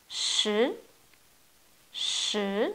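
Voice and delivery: « Shi » avec un ton montant; « Shi » qui monte comme une question; shi deuxième ton. Shown as a demonstration. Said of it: "Deuxième ton". (shi au ton montant)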